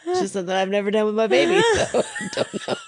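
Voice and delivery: giggling through the words, fast; fighting off laughter; laughing. Real, not acted. Laughing fit while reading.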